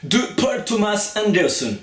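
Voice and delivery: Paul Thomas Anderson said slowly and high in a mock English accent. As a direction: lay on a bit of an English accent for the name. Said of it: "j'ai fait un petit peu l'accent". (petit accent anglais moqueur, lent et aigu)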